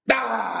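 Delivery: sudden high yelp of triumph; high triumphant yell. Acted. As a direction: let out a short victory shout. Yell after finishing the verse.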